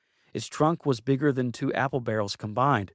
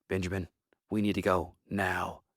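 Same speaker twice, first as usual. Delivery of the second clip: low, soft growling dog voice; 6 semitones lower, 5 dB quieter than usual. Acted. Miss Hound urges Benjamin to leave, low growling voice. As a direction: growl the warning like a wary hound. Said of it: "Miss Hound growled".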